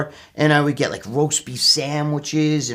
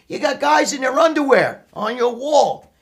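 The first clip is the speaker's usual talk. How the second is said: higher, scornful dad questioning; mocking father; 9 semitones higher than usual. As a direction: sneer it like a disbelieving dad. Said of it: "my father'd be like".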